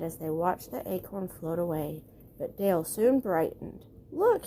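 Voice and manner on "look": high, bright "Look!"; a sudden happy discovery; excited chipmunk cry. Acted. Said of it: "he cried".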